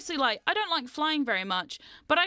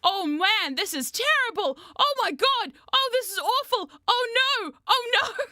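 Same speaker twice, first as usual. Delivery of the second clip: high, loud, frantic moaning of a scared steward; a retold steward's loud panic, pitched up; panicking flight attendant; 7 semitones higher, 4 dB louder than usual. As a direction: imitate the attendant losing it as the plane shakes. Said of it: "I hear one of the air stewards go".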